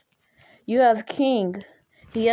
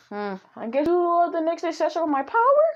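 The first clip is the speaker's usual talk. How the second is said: high-pitched character voice, questioning; acted anime mentor, sizing up his successor; Goku; 9 semitones higher, faster than usual. Voicing Goku addressing Deku.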